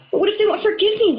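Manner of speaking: high, fast, anxious question; worried. Acted. Wolf fearing his friends won't forgive him, worried. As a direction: blurt out the worry. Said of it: "Wolf worried".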